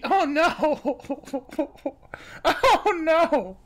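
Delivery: words breaking up with laughter; helpless laugh through a horrified reaction; real laughing. Real, not acted. Reacting to a trash spaghetti story, laughing hard.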